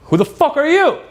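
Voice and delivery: high-pitched incredulous comeback; mock indignant challenge. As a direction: throw it back high, as if affronted. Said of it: "You say it like that".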